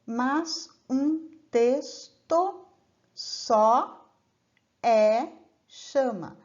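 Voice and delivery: slow, broken into separate beats, "só" and "é chama" set apart; syllable-split demo. Shown as a demonstration. Teacher breaks the verse apart slowly to show students the separate vowels. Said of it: "No terceiro verso".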